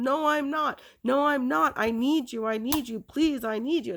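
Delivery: high, begging mock scream; retold screaming. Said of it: "screaming to Mom".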